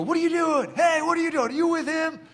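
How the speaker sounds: high, taunting jeer from the crowd; mocking crowd voice. Preacher voicing the mocking crowd at Calvary, high jeering. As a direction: sneer it like a hostile bystander.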